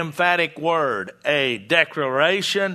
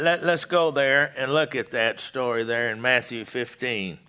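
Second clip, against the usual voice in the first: ordinary talk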